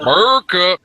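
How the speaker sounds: high, loud America bellow; loud hammed-up cheer; patriotic shout. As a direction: yell it like a proud rally crowd. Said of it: "Somebody say America".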